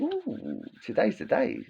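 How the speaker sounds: a robber savouring the big day, quietly thrilled; gleeful anticipation. Retold or imitated. Robber waking up happy.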